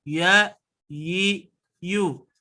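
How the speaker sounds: "Ya, yi, yu" said gently, unpressed; soft ya yi yu. Shown as a demonstration. Teacher showing the soft lin sound, gentle. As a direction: glide softly through "Ya", "yi", "yu".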